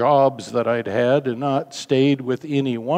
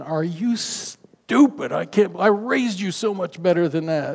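mock rant of rage; 5 semitones higher than usual. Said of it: "a rant of rage".

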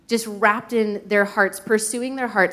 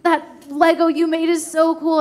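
delighted mom; 7 semitones higher than usual. Retold or imitated. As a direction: marvel at a child's Lego creation. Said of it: "he just wants my gaze".

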